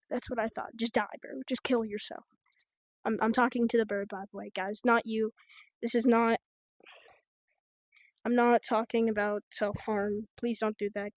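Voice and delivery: ordinary talk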